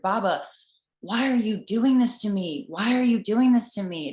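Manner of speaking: a retold cry of despair, partly voiced; anguished plea. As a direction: beg him why, reliving the pain. And I am agonizingly crying.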